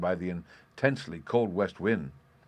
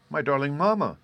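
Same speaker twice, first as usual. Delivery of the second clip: put-on higher-pitched, earnest child's voice; earnest little girl; 6 semitones higher, 4 dB louder than usual. A narrator's reading. Daughter answers her perplexed mother, in earnest. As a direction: address mamma as a serious young daughter. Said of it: "looking seriously into her mother's face".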